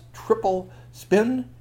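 "triple spin" high and stretched slow; storyteller savoring "triple spin"; dramatic stunt. A narrator's reading. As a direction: draw out "triple spin" with rising wonder.

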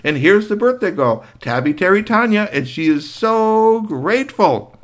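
high, smooth greeting; gracious Doctor. Acted. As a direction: charm the shopkeeper. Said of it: "calmly stepped up and said".

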